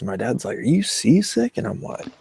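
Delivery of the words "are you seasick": higher, mock-concerned dad question; dad asking. Dad asks his puking son on the boat, higher mock-concerned question. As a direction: lightly voice the dad checking on him.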